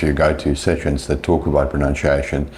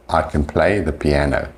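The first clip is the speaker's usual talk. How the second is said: "play" and "piano" carry the beats, "can" reduced; stress on play, piano. Shown as a demonstration. Teacher showing the positive, stress on "play" and "piano". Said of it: "Playing piano is stressed".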